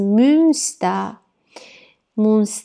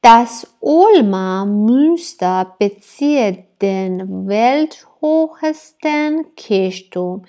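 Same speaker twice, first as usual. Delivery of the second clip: stretched out word by word; slow careful classroom reading; slow dictation reading; much slower than usual. Shown as a demonstration. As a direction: read slowly for learners to follow. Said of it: "teacher reads German slowly".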